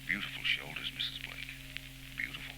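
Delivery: low, hushed admiring murmur; man murmuring to a woman as he lays her wrap on her; soft compliment. Acted. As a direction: breathe the compliment close to her ear. Vance flirts while helping with her wrap.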